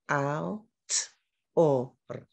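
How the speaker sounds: "Au, t, o, r" as four separate Spanish sounds; segmenting "Au, t, o, r" for learners. Shown as a demonstration. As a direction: break "Autor" into isolated sounds with pauses. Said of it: "How many sounds in Spanish?".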